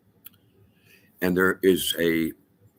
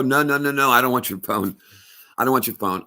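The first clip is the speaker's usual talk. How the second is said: higher, louder imitation of panicked protest; mock frantic refusal; 6 semitones higher, 4 dB louder than usual. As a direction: act out waving away an offer, urgently. Acts out a refusal that didn't happen.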